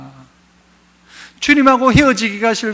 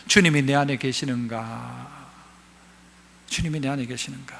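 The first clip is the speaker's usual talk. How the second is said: much softer, lower, fading second time; hushed question; 9 semitones lower, 11 dB quieter than usual. Acted. Pastor quietly asking the congregation to search themselves. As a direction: whisper it inward.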